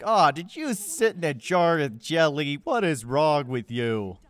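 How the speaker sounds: slow, loud exasperated teasing; hammed-up exasperated rebuke; mock scolding. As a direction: drag the words out in mock disgust. Acted scolding of companion.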